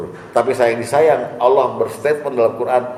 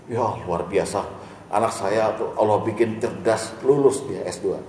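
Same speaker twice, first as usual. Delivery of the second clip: soft, quiet thankful telling; humble gratitude; 3 semitones lower, 7 dB quieter than usual. Retold or imitated. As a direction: give thanks modestly, quietly. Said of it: "Allah aja disebut". (ungkapan syukur yang rendah hati dan pelan)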